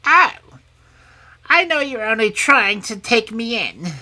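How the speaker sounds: high, loud crone voice; suspicious old hag. A narrator's reading. Hag doubting the princess's offer, suspiciously.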